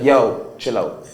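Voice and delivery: imitating dad, loud on the first word. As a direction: punch the opening, then settle into a firm order. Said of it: "a loud, deeper voice is like".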